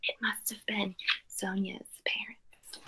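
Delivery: soft whispered aside; teacher whispering a guess to the class. Real, not acted. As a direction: lean in and whisper the secret.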